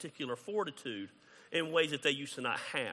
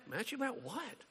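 mock puzzled; 8 semitones higher, 10 dB quieter than usual. Pastor's puzzled reply, retold.

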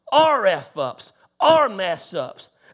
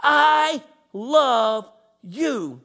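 tender voice of God; 5 semitones higher, slower than usual. Retold or imitated. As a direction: gently hush the listener, then linger on love. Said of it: "And God says".

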